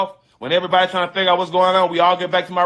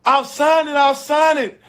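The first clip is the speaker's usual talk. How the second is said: high, wailing mock sob; imitated crying plea; 10 semitones higher than usual. Bride begs Steve after the wedding is called off, high wailing mock cry. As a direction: do the bride sobbing it out, pitched way up. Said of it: "she hard down crying".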